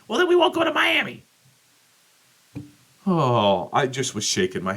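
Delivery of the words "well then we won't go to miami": very high, fast mimicry of his wife; high mocking impression of the wife. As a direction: squeak her answer like a naive wife. Mimicking wife's reply.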